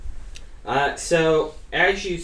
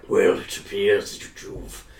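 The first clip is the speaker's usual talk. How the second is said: low, slow, put-on creature voice; creature's character voice; 9 semitones lower, 7 dB quieter, slower than usual. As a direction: draw it out low for a stealthy creature. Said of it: "do a good accent".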